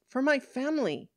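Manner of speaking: high-pitched defensive justification; defensive protest. Acted. Woman excusing her punch to police, defensive protest. Defensive answer about drinking.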